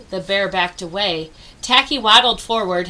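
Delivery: ordinary delivery, nothing marked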